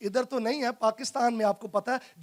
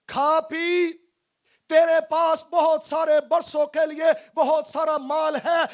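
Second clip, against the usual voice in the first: raised, declaimed boast to himself; foolish rich man; 5 semitones higher, 4 dB louder than usual. Acted. Preacher voicing the rich fool telling his soul to feast, declamatory. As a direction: proclaim it higher, as the self-satisfied fool. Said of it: "वो पता क्या कहता है नादान शख्स".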